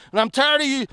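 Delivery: ordinary talk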